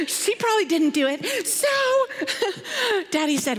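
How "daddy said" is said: usual speaking voice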